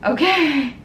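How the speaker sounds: thanking viewers as tears start; emotional. Real, not acted. Thanking her community for saving her, emotional. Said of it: "I'm actually getting emotional".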